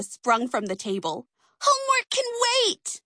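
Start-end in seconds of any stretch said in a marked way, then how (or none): 1.6-3.0 high gleeful cheer. Acted. Dot and Rita springing from the table, high gleeful cheer. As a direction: cheer it as you dash off. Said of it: "they cheered".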